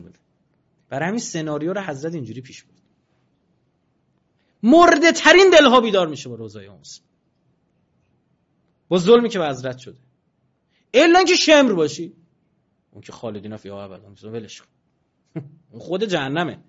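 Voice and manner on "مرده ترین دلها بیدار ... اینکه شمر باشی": much higher, much louder fiery sermon; preaching at full force. Acted. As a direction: thunder the question at the congregation. Preaching climax on Karbala.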